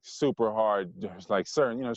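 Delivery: ordinary talk, nothing marked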